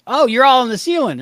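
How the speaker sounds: loud, high mock call; imitated shout. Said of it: "when he just shouts out the obvious".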